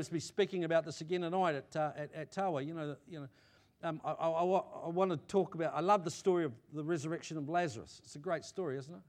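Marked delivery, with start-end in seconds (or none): none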